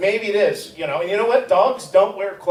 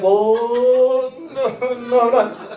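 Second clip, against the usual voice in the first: higher, louder, laughing through it; mock defiant; 5 semitones higher, 5 dB louder than usual. Father to his daughter, brushing her off: higher, laughing. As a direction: scoff it off, laughing. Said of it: "I'm like".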